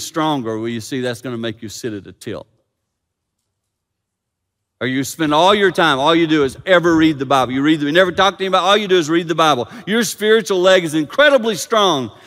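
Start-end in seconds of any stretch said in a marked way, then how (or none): 4.8-12.2 fast, loud, raised tirade; full-force preaching. Real, not acted. Preacher warning those who only read the Bible, full-force preaching. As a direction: rattle it out loudly from the pulpit.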